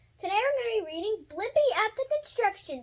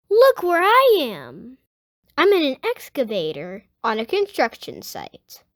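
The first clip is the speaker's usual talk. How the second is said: lively picture-book read-aloud; reading to children; 7 semitones lower, 7 dB louder, slower than usual. Acted. Host reading a Blippi book to young viewers, lively read-aloud. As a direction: read it out to little kids, bright and clear.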